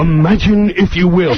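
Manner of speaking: measured, ominous TV host intro; full Rod Serling impression. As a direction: snap into a deliberate narrator voice. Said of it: "he knew to save it for the live".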